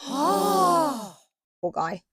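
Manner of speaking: sharp gasp then a high poor guy; gasp, then pity him; high sympathetic gasp; gasp of sympathy. Real, not acted. Pities Chaol after the killing.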